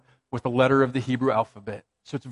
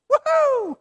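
mock celebration. Sarcastic cheer at shingles news.

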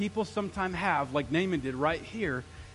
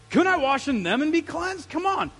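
indignant protest, higher and louder; ticked-off Naaman; 8 semitones higher, 6 dB louder than usual. Acted. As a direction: act out an offended, angry complaint. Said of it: "he turned and he went off ticked".

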